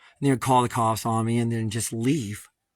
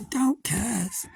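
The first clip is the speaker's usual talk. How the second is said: high, prissy mock scolding voice; mocking the neighbor; 11 semitones higher than usual. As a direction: sneer it like a fussy scold.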